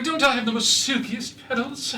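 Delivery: high, preening flower voice; vain bickering flower. Acted. DM voices a vain flower to the party, high and preening. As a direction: primp like a vain talking flower. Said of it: "Just be like".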